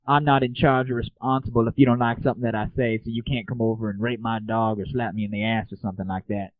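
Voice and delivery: usual speaking voice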